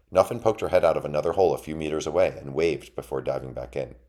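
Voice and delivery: ordinary delivery, nothing marked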